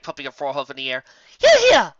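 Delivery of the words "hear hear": much higher and louder, shrill cheer. Acted. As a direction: toast with delight. Rarity cheering.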